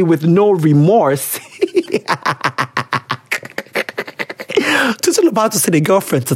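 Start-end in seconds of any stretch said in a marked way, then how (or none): 1.6-5.0 helpless laugh trailing out; long real laughing fit. Real, not acted. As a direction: laugh on and on until breath runs out. Cracks up at the letter.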